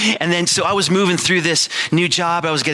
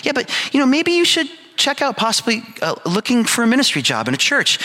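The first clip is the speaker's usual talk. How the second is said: much higher, put-on voice for his wife; wife suggesting ministry, much higher; wife's voice; 10 semitones higher than usual. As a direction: play the wife gently pushing him. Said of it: "So he said".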